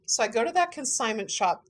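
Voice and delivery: ordinary talk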